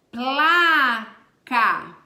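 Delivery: placa said loud and careful, the P and L both sounded; clear pronunciation. Shown as a demonstration. Teacher pronounces placa to show the P and L sounds, loud. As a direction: sound out placa loudly so the P and L ring. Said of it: "você pronuncia o som de P e".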